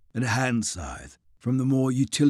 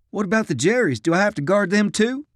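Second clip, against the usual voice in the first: put-on high, nervous boyish voice; young soldier voice; 10 semitones higher, 4 dB louder, faster than usual. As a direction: ask like a nervous kid.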